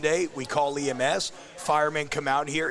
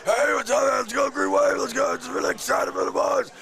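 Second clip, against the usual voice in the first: hoarse coach voice; 10 semitones higher than usual. Retold or imitated. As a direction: shout it through a wrecked throat like a worn-out coach. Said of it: "I'm gonna be like Willie Fritz".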